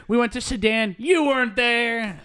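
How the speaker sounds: high-pitched indignant complaint; hammed-up aggrieved henchman; mock outrage. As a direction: protest it high, dragging out the accusation. Henchmen's complaint to Snidely acted out.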